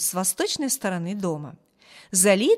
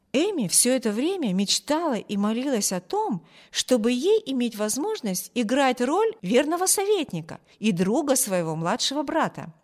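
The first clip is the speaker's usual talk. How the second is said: ordinary delivery, nothing marked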